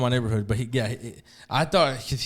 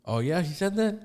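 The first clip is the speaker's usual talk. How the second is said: higher, rising questioning tone; retold surprised question; 8 semitones higher than usual. Storyteller as supervisor hearing of the threat, surprised. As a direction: imitate a supervisor checking a startling claim.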